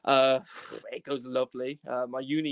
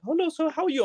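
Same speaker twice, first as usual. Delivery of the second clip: high, loud Indian call-centre greeting; Indian accent; 6 dB louder than usual. Retold or imitated. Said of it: "it's some Indian person speaking".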